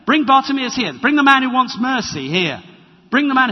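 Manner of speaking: loud, high commanding call; Jesus calling out. Acted. Jesus ordering the crowd to bring the beggar, loud. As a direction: call for him to be brought. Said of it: "That's how Jesus responds".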